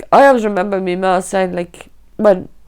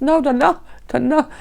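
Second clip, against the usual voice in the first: higher-pitched pleading denial, not shouted; imitating distressed disbelief, restrained volume; retold hysterical denial; 6 semitones higher than usual. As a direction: recount a panicked refusal, voice lifting. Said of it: "I went hysterical".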